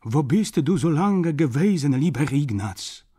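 put-on high, loud old German grandmother; old woman. A narrator's reading. Grandmother to Ignaz back from war, excited old-woman voice. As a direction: exclaim the question as an old woman. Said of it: "wysunęła się staruszka".

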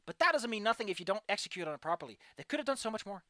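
usual speaking voice

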